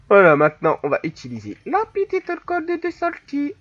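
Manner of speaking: loud hammed-up Italian accent; singsong mock Italian accent. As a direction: swoop up high with an exaggerated Italian accent. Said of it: "je vais prendre l'accent italien".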